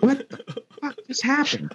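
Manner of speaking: slow, high, stunned inner voice; baffled inner thought. Retold or imitated. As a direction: let the disbelief hang, slow and high. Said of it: "I'm thinking to myself".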